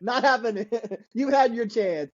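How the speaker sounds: high, amused brush-off; playful high-pitched dismissal; mock refusal. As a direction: turn them down through a chuckle. Acting parents' refusal, laughing.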